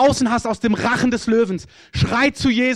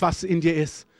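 ordinary delivery

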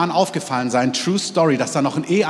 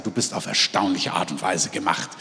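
lower, softer, sincerely spoken to a neighbour; gentle demonstration; 8 semitones lower, 6 dB quieter than usual. Shown as a demonstration. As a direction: say it softly as a blessing. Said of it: "ihr müsst's euch einmal gegenseitig sagen".

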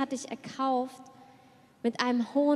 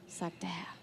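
whisper; 6 semitones lower, 13 dB quieter than usual. Real, not acted. Whispered tag after the Lord's word.

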